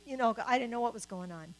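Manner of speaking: usual speaking voice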